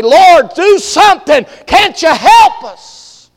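high, loud, drawn-out cry for help; desperate shout. Acted. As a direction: yell over the storm, stretching each word. Sailor cries out in a storm.

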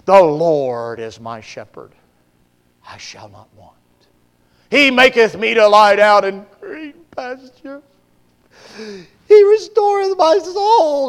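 slow, swelling pulpit reading, rising high on the last line; over-the-top solemn recitation of the psalm; hammed-up scripture reading. Preacher reading Psalm aloud.

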